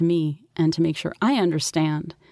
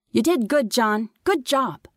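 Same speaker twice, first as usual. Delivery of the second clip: lightly voiced higher boy; brother's praise; 6 semitones higher than usual. A narrator's reading. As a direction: praise a little brother approvingly. Brother praising little John.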